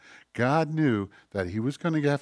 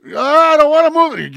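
high, loud, whining protest; whiny clay; 15 dB louder than usual. Acted. Clay refusing the potter's shaping, high whiny protest. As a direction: whine it like stubborn clay resisting.

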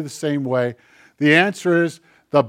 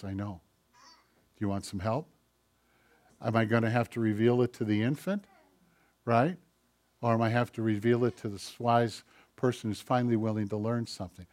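much softer, lower and hushed; gentle God; 5 semitones lower, 12 dB quieter, faster than usual. Acted. Preacher voicing God answering the believer, hushed and gentle. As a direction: murmur it kindly, like a patient father.

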